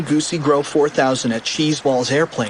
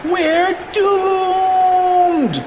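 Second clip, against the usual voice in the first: high, drawn-out wail of doom; hammed-up panicked shout; despairing shout; 3 dB louder than usual. A narrator's reading. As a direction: shout it high like a doomed cartoon kid. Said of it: "shouted Cheeseball".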